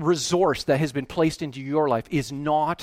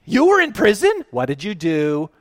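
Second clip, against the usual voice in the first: higher, louder, puzzled question; lightly voiced baffled sheep; 7 semitones higher, 9 dB louder than usual. Sheep puzzled at the king's praise, lightly mocked. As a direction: let the surprise squeak out. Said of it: "they react with complete ignorance".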